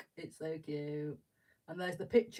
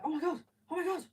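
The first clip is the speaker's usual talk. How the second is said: much higher, louder and fast squeal; fangirling; 10 semitones higher, 5 dB louder, much faster than usual. Retold or imitated. Fan meeting Monkey from the band, fast high squeal. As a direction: gush like a starstruck fan. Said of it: "proper fangirling".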